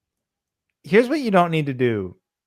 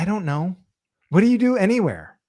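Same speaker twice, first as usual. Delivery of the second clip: hammed-up exasperation, higher; exasperated retort; 5 semitones higher than usual. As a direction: explain the obvious, fed up.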